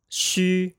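Shu held high and level with rounded lips, Mandarin xu; pinyin xu first tone. Shown as a demonstration. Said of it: "Let's try that again".

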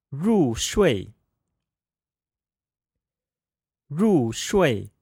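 入睡 said rù shuì, two falling tones; 入睡 with a fourth tone on both syllables; practicing tones. Shown as a demonstration. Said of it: "fourth tone pair".

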